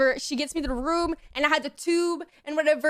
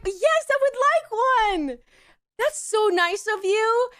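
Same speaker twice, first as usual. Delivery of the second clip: high, slow, gushing thanks; drugged-out gratitude; 7 semitones higher, 3 dB louder, slower than usual. Retold or imitated. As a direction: stretch the words in woozy delight. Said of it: "I drugged out, drugged out".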